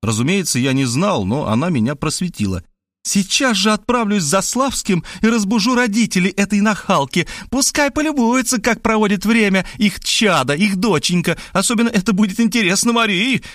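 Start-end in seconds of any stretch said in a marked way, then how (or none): 3.1-13.4 higher female voice, vengeful; threatening woman. Acted. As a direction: announce the revenge coldly. Woman threatens to wake parents. (высокий мстительный женский голос)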